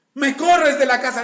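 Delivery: hurt outrage, hammed up; indignant. The other person protesting being thrown out. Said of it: "acted quarrel".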